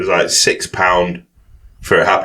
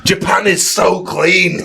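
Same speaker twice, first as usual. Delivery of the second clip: put-on high, drawn-out drunken proclamation; mock drunk exclamation; 9 semitones higher, slower than usual. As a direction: imitate a drunk gushing, high and slow. Imitating drunk man licking floor.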